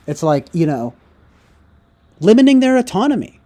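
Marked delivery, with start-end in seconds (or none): 2.2-3.4 high, loud, scornful mock of her words; mocking exaggeration. Shown as a demonstration. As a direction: sneer the phrase out high and loud. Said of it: "she didn't say it like this".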